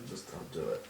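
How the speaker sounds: hushed don't-do-it whisper. Real, not acted. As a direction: breathe the words under your breath. Hushed plea.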